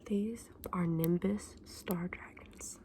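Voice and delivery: normal talking voice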